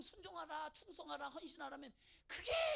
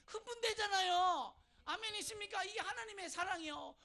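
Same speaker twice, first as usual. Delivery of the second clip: fervent preaching; 6 semitones higher, 7 dB louder than usual. Acted. Preaching at rising force.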